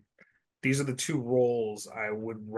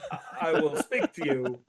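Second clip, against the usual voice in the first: laughing; 4 semitones higher, 4 dB louder than usual. Pretend. Walken as robot, laughing.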